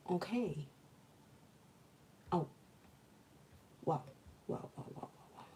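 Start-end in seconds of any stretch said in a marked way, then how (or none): none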